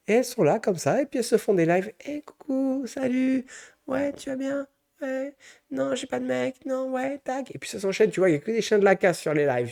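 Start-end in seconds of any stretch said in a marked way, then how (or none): 2.1-7.5 put-on much higher, cutesy TikTok girl greeting fans; mock girly livestream. Mocking a TikTok girl chatting to her viewers, high and cutesy.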